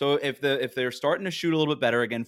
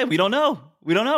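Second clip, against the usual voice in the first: high, loud exasperated outburst; 9 semitones higher, 6 dB louder than usual. Real, not acted. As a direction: throw up your hands, we don't know. Exasperated repeated cry.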